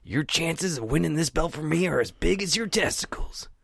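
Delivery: slow, whispered trash talk; menacing whispered promo; wrestling promo. Pretend. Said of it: "Then I cut my promo".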